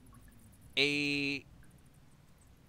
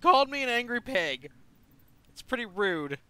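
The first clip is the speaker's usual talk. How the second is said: mock offended; 8 semitones higher, 7 dB louder than usual.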